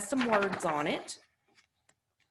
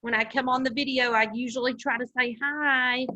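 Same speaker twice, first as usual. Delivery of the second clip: usual speaking voice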